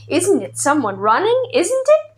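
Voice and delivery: rising, hopeful excited question; eager question. A narrator's reading. Boy asking the old man, rising eagerly. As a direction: ask it with building hope.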